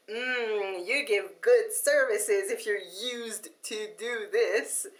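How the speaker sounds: slow, drawn-out sarcasm with a laugh; sarcastic. Real, not acted. Customer mocks the courier that lost her package, sarcastic. Mocking the courier's poor service.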